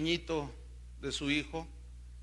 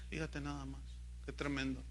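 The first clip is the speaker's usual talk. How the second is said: hushed aside; 5 semitones lower, 12 dB quieter than usual. Real, not acted. Awed aside in sermon.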